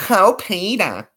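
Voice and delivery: high, drawn-out wifely whine; cartoon wife impression. Shown as a demonstration. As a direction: chide a husband in a high cartoon voice. Family Guy wife impression follows Peter.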